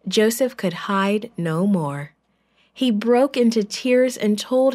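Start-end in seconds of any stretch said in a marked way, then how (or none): none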